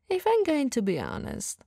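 ordinary talk, nothing marked

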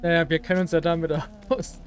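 ordinary talk, nothing marked

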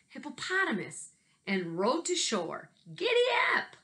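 lively picture-book read-aloud with big swings; reading to children. Acted. Teacher reading a picture book to her class, lively. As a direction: tell it like a story hour for kids.

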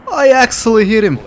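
high, loud and thrilled; excited. Real, not acted. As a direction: burst out in real delight. Surprised at own hit.

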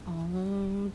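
usual speaking voice

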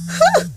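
sharp high-pitched huh; mock huh. Real, not acted. Vlogger warning celebrities with a high mocking huh. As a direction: yelp a knowing huh.

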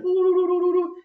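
high, loud dazed "ooh" cries; hammed-up dazed ooh-ing of a beaten man; dazed moaning. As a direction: groan like a man knocked senseless. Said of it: "he's like".

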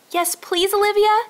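high, bright eager plea; eager child. A narrator's reading. Little girl eagerly agreeing to help Olivia. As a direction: beg sweetly to join in. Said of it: "agreed Emma".

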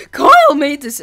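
exaggerated retelling, loud; Connor. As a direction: blurt it in a mock voice. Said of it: "I'm just gonna talk in a normal voice".

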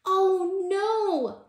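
high, loud cry of alarm. A narrator's reading. Tortoise startled out of his new bed, crying out. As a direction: call out in storybook dismay. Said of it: "cried Tortoise".

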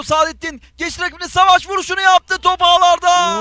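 high, rising goal call; excited football commentator. Real, not acted. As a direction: build up to the goal and shout it.

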